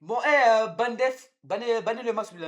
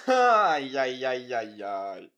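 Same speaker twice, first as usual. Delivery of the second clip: descending wail; 7 semitones lower, 6 dB louder, much slower than usual. Real, not acted. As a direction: groan downward in drawn-out dismay. Wails over dough on fingers.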